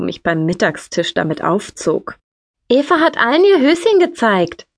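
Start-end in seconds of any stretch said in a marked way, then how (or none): 2.7-4.7 high, singsong brotherly teasing; mocking tell-tale lilt. A narrator's reading. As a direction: tattle on your sister, pitched way up. Brother teasing at lunch table.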